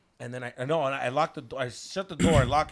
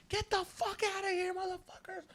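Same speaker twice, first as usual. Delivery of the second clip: mock angry eviction. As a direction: imitate someone shrieking them out the door. Thrown out of the house.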